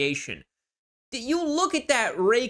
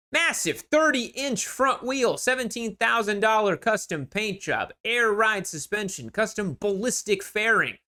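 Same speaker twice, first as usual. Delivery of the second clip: raised-pitch announcer rattling off features; hyped listing read; 5 semitones higher than usual. Acted. Seller listing custom bike features, high-pitched announcer hype. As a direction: sell each feature like a big deal.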